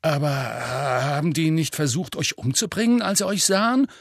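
incredulous. Acted. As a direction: voice climbing in disbelief. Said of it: "fragte Ron ungläubig". (ungläubig, immer höher)

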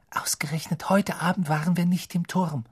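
unvoiced whisper of a girl. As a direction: hush it so no one hears. Children whisper in sleeping bags.

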